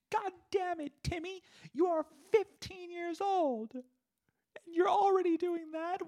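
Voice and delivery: hammed-up falsetto scolding, very high; mom freaking out. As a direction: rant like a panicked, furious mother. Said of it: "she's freaking out".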